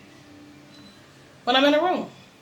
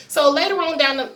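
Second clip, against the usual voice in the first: usual speaking voice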